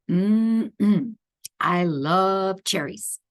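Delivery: plain reading voice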